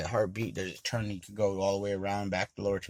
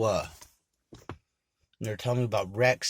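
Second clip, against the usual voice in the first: normal talking voice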